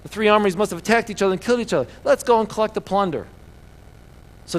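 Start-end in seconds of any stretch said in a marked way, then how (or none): 0.0-3.3 high, loud, hurried cry; rally the troops to the spoils; excited exclaiming. A narrator's reading. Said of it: "they cried, the Moabites exclaimed".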